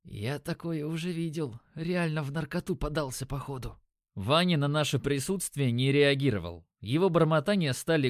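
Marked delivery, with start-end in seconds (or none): 0.0-3.8 soft, hushed aside; whisper. A narrator's reading. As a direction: whisper it under your breath. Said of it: "хмыкнул и прошептал". (шёпотом, тихо)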